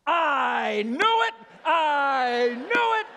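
very slow, high, drawn-out vindicated yell; triumphant shout. Retold or imitated. Old fan proven right in front of his family, triumphant shout. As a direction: stretch it into a huge imitated cry of triumph.

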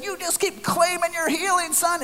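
high-pitched preacher's holler; hollered send-off. Retold or imitated. Healer pushing him out the door, hollering. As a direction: call it out high, imitating the healer. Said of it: "as they push us out the door".

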